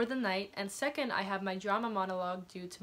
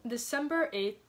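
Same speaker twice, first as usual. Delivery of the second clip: usual speaking voice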